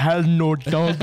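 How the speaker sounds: loud mock refusal. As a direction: hit it hard like a tough guy. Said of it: "They don't talk like that".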